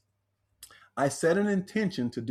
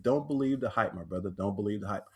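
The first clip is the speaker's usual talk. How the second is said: low, soft and steady; softer, lower brotherly advice; calm reassurance; 7 semitones lower, 3 dB quieter than usual. Retold or imitated. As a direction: quietly reassure a stranger, hand on his shoulder. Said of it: "I said".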